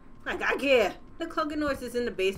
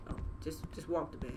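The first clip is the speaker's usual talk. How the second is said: hushed whisper; 9 semitones lower, 11 dB quieter than usual. Real, not acted. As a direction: whisper it, softer. Sneaking, afraid of waking parents.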